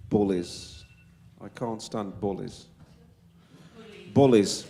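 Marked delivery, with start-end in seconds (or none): 4.1-4.7 Wolverhampton accent. Real, not acted. Politician at a public meeting denouncing bullies, Wolverhampton accent slipping out loud. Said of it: "Sorry, it's my Wolverhampton accent coming out there".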